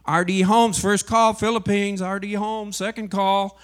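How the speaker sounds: loud mock boarding call over the airport speakers. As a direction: call his name out like a gate announcement. Airport calling his name.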